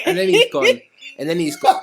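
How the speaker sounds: breaking into high laughter, slowed; laughing. Real, not acted. Telling the story to friends, cracking up laughing. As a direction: laugh through the words.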